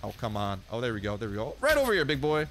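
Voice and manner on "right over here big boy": calling out. Pretend. Calls the gator over to him.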